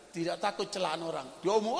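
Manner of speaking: ordinary delivery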